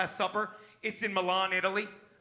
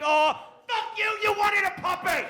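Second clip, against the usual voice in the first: a hammed-up higher shout at his niece; mock angry shout; 9 semitones higher than usual. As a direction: yell it at the kid in fake fury. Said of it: "I'm like".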